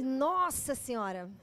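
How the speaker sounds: laughing through the words; laughing at her own sweetness. Real, not acted. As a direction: let the laugh carry it. Laughing at herself.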